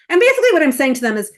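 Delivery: usual speaking voice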